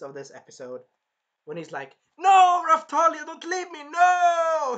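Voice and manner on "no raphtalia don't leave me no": loud, high hammed-up wail; imitated anguished cry; desperate pleading. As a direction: beg at full volume not to be abandoned. Voicing the hero begging desperately.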